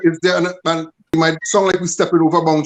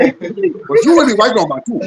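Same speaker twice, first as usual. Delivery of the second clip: put-on light West African accent, playful; imitating a West African accent; 5 semitones higher than usual. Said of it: "my best West African accent".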